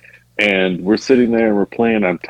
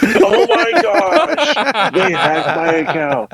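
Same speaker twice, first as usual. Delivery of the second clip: very high, drawn-out mock dismay; hammed-up panicked wail through laughter; 11 semitones higher, slower than usual. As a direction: play up the horror, laughing. Acts out panic at hacking.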